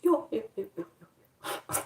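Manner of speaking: high yo fading to a whisper; playful fading yo to viewers. Real, not acted. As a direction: trail off playfully.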